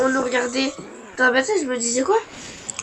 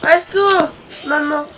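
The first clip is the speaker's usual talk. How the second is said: mock cry for help; 4 semitones higher, 6 dB louder than usual.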